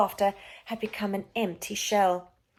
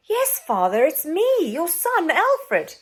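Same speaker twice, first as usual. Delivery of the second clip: put-on eager boy; 9 semitones higher, 7 dB louder, slower than usual. Boy answers father.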